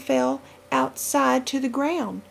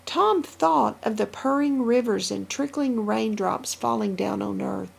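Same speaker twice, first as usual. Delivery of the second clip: plain reading voice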